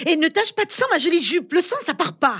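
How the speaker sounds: high-pitched fussy complaint. Acted. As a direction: whine about the skirt, high. Yvette fussing about her skirt.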